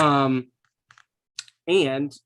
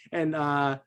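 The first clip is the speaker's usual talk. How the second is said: normal talking voice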